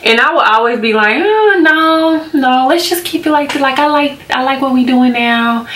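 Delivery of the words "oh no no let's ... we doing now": high-pitched, drawn-back demurral; imitating herself politely balking; reluctant refusal. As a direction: gently turn someone down in a higher voice. Acting out her repeated hesitant refusals.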